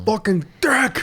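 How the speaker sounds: high, drawn-out New York curse; mock Italian New York outburst; New York accent. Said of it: "Just an Italian New York accent".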